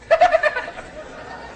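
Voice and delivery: breathless high-pitched giggle; high wheezy laugh. Real, not acted. Man cracks up at his own pregnancy joke, high wheezy laugh.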